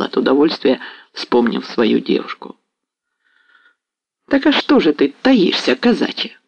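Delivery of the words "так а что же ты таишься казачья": higher, sly teasing question with a smile; sly, smiling. A narrator's reading. Mazepa teasing the young Cossack, slyly smiling. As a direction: tease him slyly, grinning. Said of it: "продолжал с лукавой улыбкой Мазепа".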